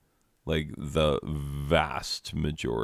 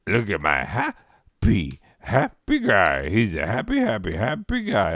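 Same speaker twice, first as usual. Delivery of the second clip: mock meme voice; 2 semitones higher, 3 dB louder than usual. Mocking the meme audio slapped on every video, lightly sing-song. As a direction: parody the overused clip, hamming it up a bit.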